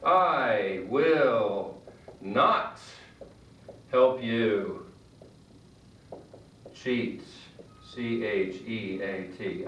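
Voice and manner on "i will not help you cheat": I will not help you cheat spaced out, each word firm; firm, slow refusal. Shown as a demonstration. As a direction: refuse slowly and firmly. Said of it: "will is used for refusing".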